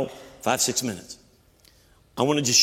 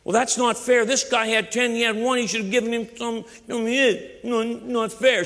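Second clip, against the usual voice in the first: high whining mock complaint; hammed-up grumble of unfairness; whiny protest; 10 semitones higher than usual. Child complains to father that it's not fair, high whiny mock protest.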